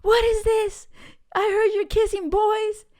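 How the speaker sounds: high, loud mock angry brother voice; angry big brother. Big brother scolding his little sister, high and loud mock anger. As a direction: play the outraged big brother, voice pushed way up.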